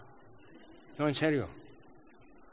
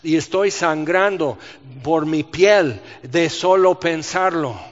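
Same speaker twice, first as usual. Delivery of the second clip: anguished prayer; 3 semitones higher, 7 dB louder than usual. Acted. Jesus voiced praying at Gethsemane.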